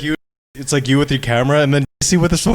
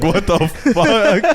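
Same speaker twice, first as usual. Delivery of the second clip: laughing disbelief; 7 semitones higher, much slower than usual. Retold or imitated. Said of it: "And I'm just like".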